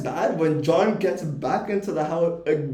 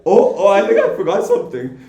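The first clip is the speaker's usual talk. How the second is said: louder, mock flustered dithering; flustered Rudy; 5 semitones higher, 9 dB louder than usual. Mocking Rudy forgetting things while they wait, flustered. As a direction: ham up a scatterbrained friend. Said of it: "And then he's like".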